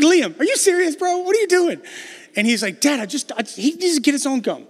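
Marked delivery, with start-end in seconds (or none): 0.0-1.8 much higher and louder, an incredulous dad's outburst; exasperated dad. Retold or imitated. Dad scolding his son Liam for hoarding gum, exasperated. 2.8-4.7 higher-voiced kid whining that the gum is his; whiny kid. Son defending his gum to dad, whiny.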